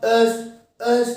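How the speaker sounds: vowel-first एस demo; sounding out ess. Shown as a demonstration. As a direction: say ess slowly, stressing the opening vowel. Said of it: "इसको बोल के देखो आप".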